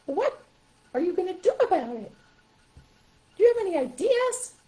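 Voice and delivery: high, questioning puppet voice; high-pitched animal helper; stuffed animal voice. Shown as a demonstration. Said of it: "walks up to him and goes".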